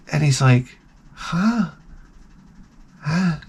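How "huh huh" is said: put-on puzzled little boy. Puzzled young son voiced.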